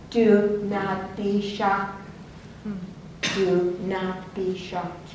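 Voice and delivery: slow, measured command; solemn voice. Retold or imitated. Inner voice warns the driver, slow and solemn. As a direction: spell it out word by word, unhurried.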